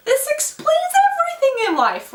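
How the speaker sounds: very high, hammed-up revelation; mock-ecstatic epiphany, pitch way up; dramatic realization. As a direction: exclaim it as a huge revelation. Exaggerated realization, climax of list.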